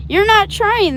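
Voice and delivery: high, loud stern telling-off; severe scolding. A narrator's reading. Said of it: "talking to him severely".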